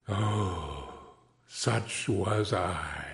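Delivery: a hushed mournful repeat; fading lament. A narrator's reading. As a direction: let it die away in sorrow. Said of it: "cried the phantom".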